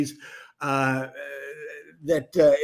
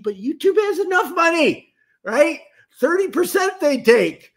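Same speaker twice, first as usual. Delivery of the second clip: playful indignation; 10 semitones higher than usual. Real, not acted. Teasing YouTube about its cut.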